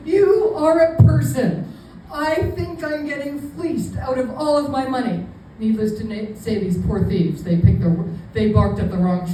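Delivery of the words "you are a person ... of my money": emphatic mock plea. Appealing to the bank worker as a person, mock-desperate. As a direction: plead emphatically in a raised voice.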